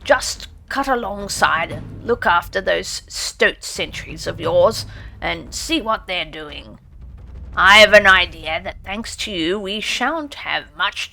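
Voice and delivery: steady praise from an old badger; gruff badger. A narrator's reading. Badger voiced in story.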